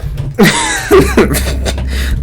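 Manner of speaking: high, breath-filled laughing fit; wordless burst of laughter; real laugh. Real, not acted. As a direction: crack up helplessly. Cracks up at own fumbled intro.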